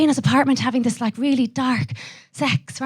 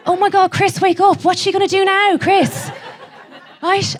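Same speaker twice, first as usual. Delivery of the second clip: high, urgent and thrilled; hammed-up gushing excitement, raised pitch; excited wake-up call; 7 semitones higher, slower than usual. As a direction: wake him up, you're gripped by the plot. Waking sleeping boyfriend, absorbed in film.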